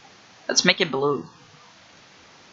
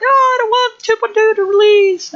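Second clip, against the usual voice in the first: very high, loud mock whine; exaggerated high-pitched protest; mad complainers, mocked; 6 dB louder than usual. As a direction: imitate whining fans in a squeaky voice. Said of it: "a lot of people being mad".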